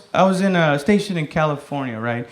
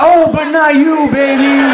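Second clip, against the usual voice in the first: high, loud, slow mock reassurance; loud backpedaling; 9 semitones higher, 6 dB louder, slower than usual. Comic placating his offended girlfriend, loud backpedaling.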